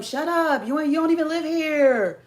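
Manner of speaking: high-pitched mock outrage; mock angry telling-off. Imitating people telling Jamaicans off, high mock outrage.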